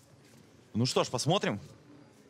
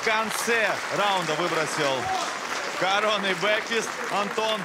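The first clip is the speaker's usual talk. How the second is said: excited commentary; 7 semitones higher than usual. Real, not acted. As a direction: ride the rising tension of the round. Commentator at the end of the round. (азартный комментарий)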